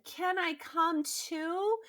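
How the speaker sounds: a sweetly begging wolf; pleading wolf. Acted. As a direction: coax her to let him come. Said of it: "The wolf tried again".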